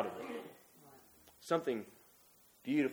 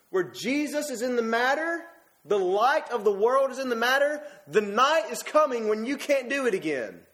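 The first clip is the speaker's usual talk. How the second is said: higher, slow and insistent warning; urgent preaching; 5 semitones higher than usual. Acted. Preacher warns the church not to miss the moment, urgent and insistent. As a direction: press the warning home.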